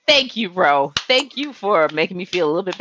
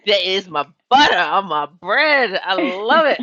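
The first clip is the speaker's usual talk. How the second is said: emphatic, higher-pitched relish; gushing delight; 3 semitones higher than usual. Real, not acted. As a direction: gush with rising enthusiasm. Gushing over making a deal.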